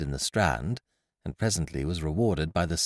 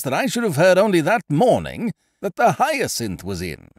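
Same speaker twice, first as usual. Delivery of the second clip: loud, higher, bubbling self-praise; boastful Ukridge; 6 semitones higher, 5 dB louder than usual. A narrator's reading. Ukridge bragging to his friend about his scheme, boastful. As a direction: sell your grand scheme with gusto.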